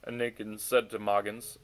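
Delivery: usual speaking voice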